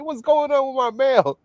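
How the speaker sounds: high hammed-up mom complaint; mock demand. Quoting mom demanding her mail.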